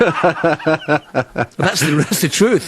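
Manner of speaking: laughing. Real, not acted. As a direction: laugh it out while admitting it. Laughing at his own boast.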